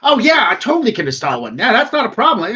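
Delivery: high, fast, eager and loud; eager droid doctor. Acted. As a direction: rattle it off, delighted to help.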